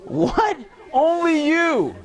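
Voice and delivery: mock surprise. Mock disbelief at student.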